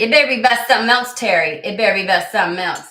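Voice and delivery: loud fast teasing warning; mock warning. Real, not acted. Streamer teasing chatter Terry, loud mock warning. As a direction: playfully scold Terry.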